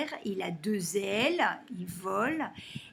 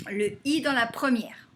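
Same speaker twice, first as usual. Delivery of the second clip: ordinary talk, nothing marked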